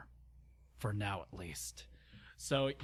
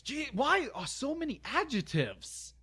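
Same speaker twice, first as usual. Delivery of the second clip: high-pitched whine of disbelief; exasperated complaint; 4 dB louder than usual. Real, not acted. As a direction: break off to gripe at the writing. Reader complains about the text.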